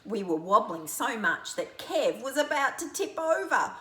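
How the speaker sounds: plain reading voice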